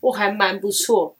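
normal talking voice

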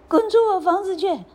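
high, fast hammed-up yell; angry shout. A narrator's reading. Mistress throws duckling out of her house, shouting. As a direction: rush out a furious order.

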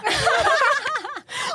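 a high, helpless burst of laughter; high-pitched laugh. Real, not acted. Laughing at her own story.

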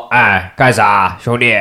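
drawn-out, loud, mock-dopey hail; goofy greeting. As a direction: fool around as you greet a buddy, stretching it out. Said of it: "就那种耍白痴那种".

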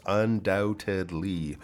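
"undoubtedly" slowly, syllable by syllable; careful articulation. Shown as a demonstration. Teacher shows the mouth shapes, "undoubtedly" slow and careful. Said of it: "a lot of different mouth shapes".

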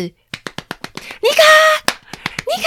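high, loud call of the name; grandma loudly calling the baby's name. Retold or imitated. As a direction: shout the baby's name to snap her out of crying. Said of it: "然后喊她的名字".

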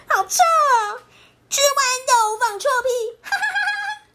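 high laughing shout at the stink; kids laughing. Acted. Classmates burst out laughing and yelling at the smell. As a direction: squeal it through giggles. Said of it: "笑得东倒西歪".

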